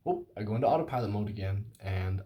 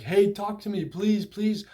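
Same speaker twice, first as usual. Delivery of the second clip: higher pleading mock plea; imitating my desperate texts, pitched up; 8 semitones higher than usual. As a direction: beg her, higher and urgent. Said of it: "kind of beg".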